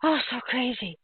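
ordinary talk, nothing marked

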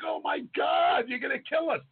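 high, stretched-out alarmed cry; mock panic. Passenger to fan driving alongside, retold panicked cry. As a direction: wail it as if about to crash.

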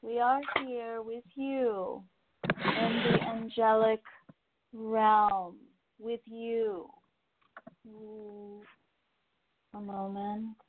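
unhurried spirit-channel voice lifting into the channel; channeling angels. Acted. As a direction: speak as the angels coming through a medium.

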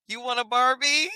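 high, coaxing offer rising at the end; distracting a child. Trying to distract an upset girl, high coaxing offer. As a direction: lift it high to tempt a child. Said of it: "I had to, like, distract her".

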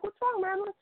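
high, breezy innocent ask; feigning innocence to a coworker, high and light; innocent question. Retold or imitated. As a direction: play it innocent. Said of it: "I'm like".

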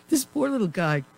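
ordinary talk

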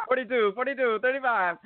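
high, shouted-style play call; quarterback call. Retold or imitated. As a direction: bark it like a quarterback at the line. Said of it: "sounded like a quarterback".

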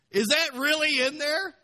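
high, shouted incredulous question; loud mock astonishment. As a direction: cry it out in hammed-up disbelief. Acted disbelief, repeated much stronger.

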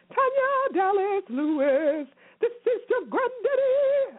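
high, slow put-on old granddaddy voice; hammed-up grandpa calling out slowly; mock granddaddy voice. Playful shout-out in put-on voice.

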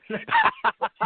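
sudden high-pitched laugh; high burst of laughter. Real, not acted. Laughs at repeated movie line.

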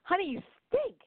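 high mock wife to husband; blunt accusation. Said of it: "And I'm like".

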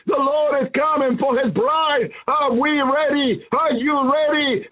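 ordinary talk

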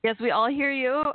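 ordinary delivery

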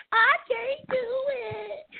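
high, slow, whiny imitation of a pleading girl; mock whiny quote. Host imitates Thea's whining while she was being yelled at. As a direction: stretch it out in a high mock whine. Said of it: "while you was like".

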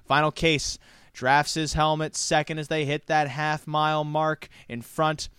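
fast, rising live race call; race caller. Real, not acted. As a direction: call the race building to the finish.

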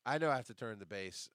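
usual speaking voice

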